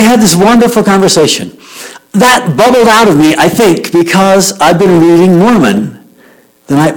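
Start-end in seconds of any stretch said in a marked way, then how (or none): none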